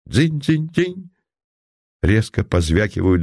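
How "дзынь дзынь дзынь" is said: spur jingle imitation. A narrator's reading.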